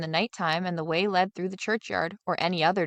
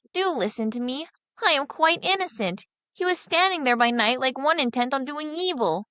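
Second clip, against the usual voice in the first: put-on higher, earnest pleading boy; boy pleading innocence to his father, higher; innocent boy; 5 semitones higher than usual. Younger son voiced.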